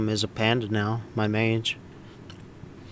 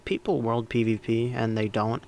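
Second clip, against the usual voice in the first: usual speaking voice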